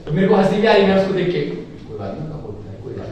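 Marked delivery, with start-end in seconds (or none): 1.3-3.1 lower, soft near-whisper; mock consoling. Teasing a rival who lost, mock consoling near-whisper. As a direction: murmur comfort while stifling a laugh. Consoling while holding back laughter.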